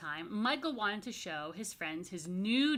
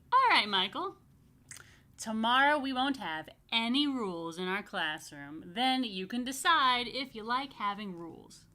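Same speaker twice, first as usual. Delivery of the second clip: louder, kindly teacher voice; a smiling teacher with a plan; lightly voiced teacher; 3 semitones higher, 8 dB louder than usual. Miss Sharon to Michael, lightly voiced kind teacher. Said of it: "Miss Sharon smiled and said".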